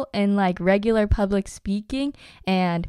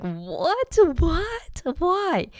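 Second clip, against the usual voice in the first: high, squeaky what-what-why in shock; stunned disbelief; 9 semitones higher, much slower than usual. Retold or imitated. Sixth grader hearing the teacher's offer, shocked high disbelief. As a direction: gasp out the questions like you can't believe it. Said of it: "I was like".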